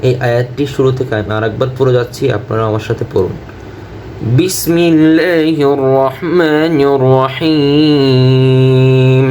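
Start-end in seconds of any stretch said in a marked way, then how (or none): 4.4-9.3 slow melodic tajweed chant; Quran recitation. Acted. Teacher reciting so students read along, melodic chant. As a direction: chant the verse, stretching long vowels.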